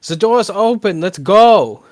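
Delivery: high, very loud rallying shout; yell to friends. Acted. Character calls his friends to the open door, full yell. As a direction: call out urgently to the party. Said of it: "He'll yell to his friends".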